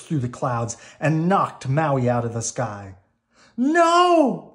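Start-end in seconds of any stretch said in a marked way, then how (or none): 3.6-4.5 high, loud panicked scream. A narrator's reading. Maui screams as Te Kā knocks him down, loud and high. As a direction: cry out as he's knocked from the sky. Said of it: "screamed Maui".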